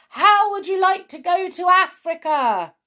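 high, loud call to Jack; excited shout. A narrator's reading. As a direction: call out over the rushing wind. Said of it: "he shouted".

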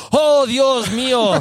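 theatrical declaiming, high, loud and slow; hammed-up dramatic cry; Naredo. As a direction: wail it like a stage actor rehearsing to an empty house. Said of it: "Y estaba Naredo".

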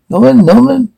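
high-pitched questioning call; calling a name. Acted. Acted mother calls son to attention.

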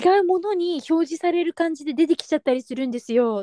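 polite, high-pitched staff explanation; shop-assistant voice. Retold or imitated. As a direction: imitate a clerk explaining to a customer. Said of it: "店員さんみたいな感じの".

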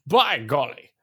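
mock old-time British exclamation; 1920s British. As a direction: exclaim like a twenties British gent. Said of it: "nineteen twenties British way".

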